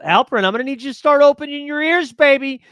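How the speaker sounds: loud, high teasing call to a viewer. Real, not acted. Streamer teasing a chat viewer, loud and high. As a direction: belt it out playfully at him.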